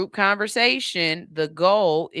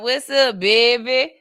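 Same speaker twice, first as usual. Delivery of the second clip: put-on playful New Orleans drawl on baby; New Orleans accent; 3 semitones higher, 6 dB louder than usual.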